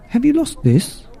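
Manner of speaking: high, hopeful question; high helpful frog voice; Mister Frog asking. Acted.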